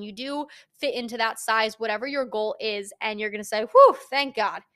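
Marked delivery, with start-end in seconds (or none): 3.6-4.6 loud, mock-relieved whew; like dropping onto the couch; relieved exhale. Dieter finally hitting goal, loud mock relief.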